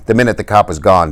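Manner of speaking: usual speaking voice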